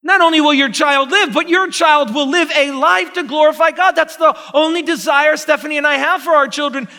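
loud, high, slowed climax of the sermon; full-voiced rising preacher's peak; preaching at full force. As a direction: belt out the joy of the promise.

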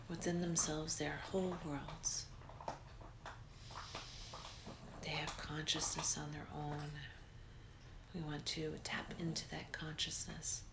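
breathy guided relaxation, unhurried; soft spa whisper. Acted. Leads a guided meditation.

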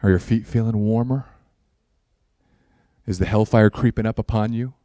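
mock-serious warning, dry; deadpan sarcasm. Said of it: "I'm being sarcastic".

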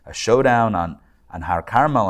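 ordinary talk, nothing marked

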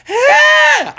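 very high, loud startled shriek; sustained high-pitched comic yelp; mock scream. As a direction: scream as if the carrier leaps away. Grabbing the leaping cat carrier.

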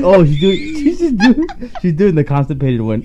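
cracking up, high and loud; helpless laughter through the mocking quote; laughing. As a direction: tease her while laughing hard. Laughing at her strained accent attempt.

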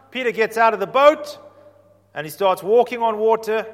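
normal talking voice

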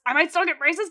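the hope bursts out; eager question. Retold or imitated. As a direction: Debbie thrilled about the braces. Debbie's question, more eager.

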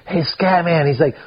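retold calling out. Mister Pat to hiker changing in his tent, retold teasing holler. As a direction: imitate a friend hollering into a tent.

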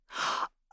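put-on a quick breathless little-girl gasp; soft delighted gasp. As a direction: gasp with wonder.

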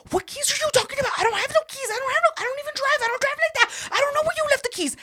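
very high, fast, frantic denial; panicked babbling. Retold or imitated. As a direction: blurt it all out in terrified panic. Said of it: "because I was so afraid".

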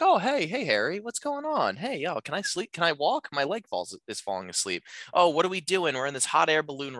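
high, dreamy and woozy, drifting; woman on Valium, mock woozy and high; drugged daze. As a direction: act someone floating on Valium. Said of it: "coming out of that Valium haze".

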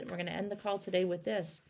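ordinary talk, nothing marked

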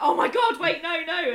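sudden high loud panic; shocked. Retold or imitated. Student catching the joke, loud shocked squeal. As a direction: blurt it out, mortified and squealing. Student realises embarrassing double meaning.

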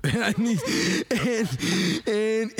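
laughing. Real, not acted. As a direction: try to go on while laughing. Amused, laughing mid-story.

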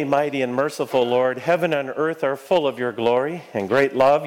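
normal talking voice

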